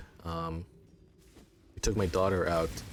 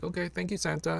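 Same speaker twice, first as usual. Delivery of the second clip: sing-song thank you; 11 semitones higher than usual. Retold or imitated. Parents thanking Santa while leaving.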